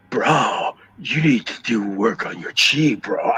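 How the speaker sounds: mock California bro accent; California surfer bro. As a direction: imitate a Californian chi-obsessed surfer dude. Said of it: "I thought she was gonna be one of them".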